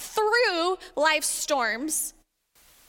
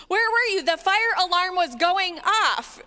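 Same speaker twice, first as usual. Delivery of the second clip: raised, incredulous family complaint; exasperated relative; 4 semitones higher, 4 dB louder than usual. Acted. Family member to the one who sleeps through everything, exasperated. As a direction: act out a loud, exasperated relative.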